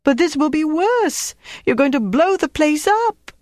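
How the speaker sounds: a frightened cleaner realising she was robbed; Antoinette's alarmed outburst. A narrator's reading. Antoinette to Flick, realising her pass was stolen: high alarmed cry.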